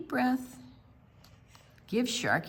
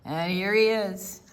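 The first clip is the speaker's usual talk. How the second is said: plain reading voice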